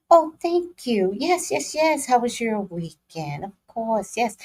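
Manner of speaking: high, airy put-on office greeting; corporate office voice.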